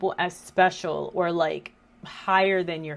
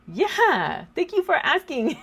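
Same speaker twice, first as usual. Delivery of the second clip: mock-gracious reply; 10 semitones higher, 5 dB louder than usual. Mom's mock-gracious reply to daughter.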